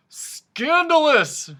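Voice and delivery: very loud, high and slow; outraged royal shout; shouting king. A narrator's reading. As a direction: bellow it in outrage. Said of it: "shouted the king".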